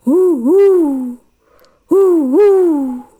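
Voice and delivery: high, loud owl hoot; an owl hooting to wake the forest. Acted. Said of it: "Owl called".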